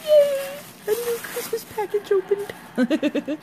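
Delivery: high squealing cheer, stretched out slowly; excited squeal. Real, not acted. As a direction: squeal with delight over the new package.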